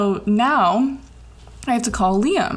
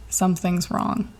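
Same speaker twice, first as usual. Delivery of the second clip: ordinary talk, nothing marked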